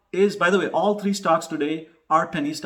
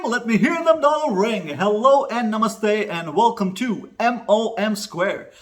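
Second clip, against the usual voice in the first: high, loud, ringing catchphrase opener; big, loud, excited welcome; hyped channel intro; 7 semitones higher, 6 dB louder than usual. Pretend. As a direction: belt out a showman's YouTube greeting.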